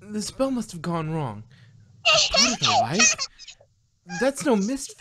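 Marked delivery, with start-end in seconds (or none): 2.1-3.1 high, loud mad laugh through words; cackling villain. Acted. Nightmare Moon mocking them, cackling.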